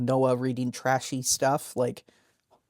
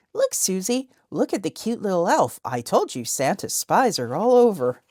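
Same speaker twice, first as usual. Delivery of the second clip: excited mom voice; 6 semitones higher, 4 dB louder than usual. Retold or imitated. Parent pointing out an elf.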